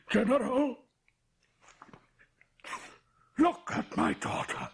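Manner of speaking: high, loud panicked cry; alarmed shout. Acted. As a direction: scream for help at the sight of her. Father sees daughter's mark, cries out.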